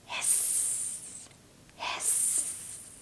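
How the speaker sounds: soft, voiceless hiss; whispered snake hiss. Shown as a demonstration. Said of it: "A snake".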